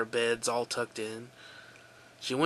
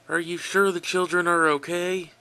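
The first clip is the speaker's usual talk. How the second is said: upset; 6 semitones higher, 7 dB louder than usual. Acted. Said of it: "He sounded upset".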